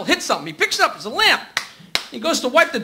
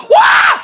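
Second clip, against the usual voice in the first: high shriek of fright; a sudden high yelp at the hamster; startled scream; 6 dB louder than usual. Acted.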